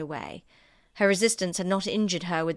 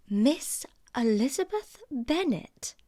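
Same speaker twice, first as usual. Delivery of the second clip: higher, slow disbelieving echo; slow, higher shocked question; incredulous repeat; 7 semitones higher, slower than usual. Acted. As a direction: repeat the name as if it's absurd. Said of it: "repeated Miss Bingley".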